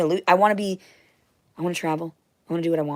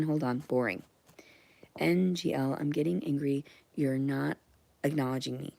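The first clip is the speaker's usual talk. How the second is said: ordinary talk, nothing marked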